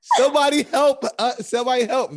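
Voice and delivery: high, loud mock plea; hammed-up call for rescue; mocking a friend begging for help; mock cry for help. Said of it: "Nala looking at all of us like".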